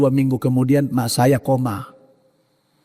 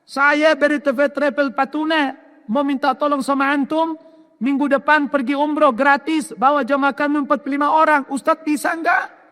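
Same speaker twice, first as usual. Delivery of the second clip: caller Bu Ida; 12 semitones higher than usual. Retold or imitated. Bu Ida phoning to invite the preacher on umrah, high polite voice. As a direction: voice the lady inviting him kindly. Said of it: "namanya Bu Ida".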